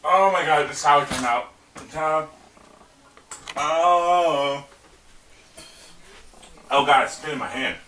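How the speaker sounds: disgusted with food in mouth; eating sour candy. Real, not acted. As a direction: talk around a mouthful of candy. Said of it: "Ew, I need".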